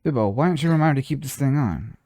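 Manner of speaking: mild mob boss ordering a goblin; light gangster boss, giving an order; Godfather accent. Acted. Godfather tells goblin Bilbo to remind her, mild mob accent. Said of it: "I love doing the Godfather".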